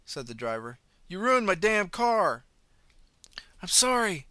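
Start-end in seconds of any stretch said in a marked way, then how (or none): 1.1-2.5 slow, loud, high furious shout; furious driver. Acted. Driver furious about his ruined car, slow shout. 3.6-4.3 loud, high out-of-breath apology; breathless. Acted. Running man gasps apology to driver, breathless. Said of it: "the man replied breathlessly".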